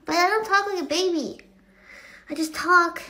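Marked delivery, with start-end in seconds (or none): 0.1-1.4 firm, raised denial; louder protest in your own voice; emphatic denial. Shown as a demonstration. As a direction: insist on it, pitched up.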